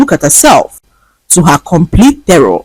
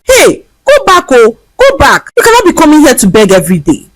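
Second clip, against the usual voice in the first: high, sharp, fast shooing; chasing away; 10 semitones higher than usual. A narrator's reading. Gateman Kunle chasing the beggar away, sharp shooing. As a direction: drive the beggar off the gate. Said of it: "rushed forward with a stick".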